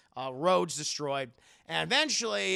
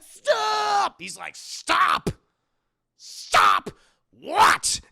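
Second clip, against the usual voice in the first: much higher, louder, anguished yelling; crying scream; 11 dB louder, much slower than usual. Retold or imitated. Son begs his father to stop, anguished crying yell. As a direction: scream stop through tears.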